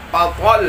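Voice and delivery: "fatal" loud and slow, "tal" accented; "fatal" with a strong lift on "tal". Shown as a demonstration. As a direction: punch and hold the end of "fatal". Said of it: "kita naikkan huruf ta".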